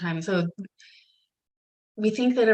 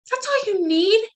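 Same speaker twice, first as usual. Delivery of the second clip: amazed disbelief; 14 semitones higher, 4 dB louder than usual. Retold or imitated. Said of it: "We're like".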